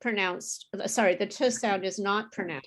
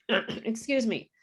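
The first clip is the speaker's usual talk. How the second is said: ordinary delivery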